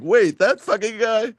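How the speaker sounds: high, slow, loud disbelief; incredulous. Acted. Co-host voicing everyone's jealous motivation, incredulous. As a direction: stretch it out in mock amazement. Listener voices the jealous reaction.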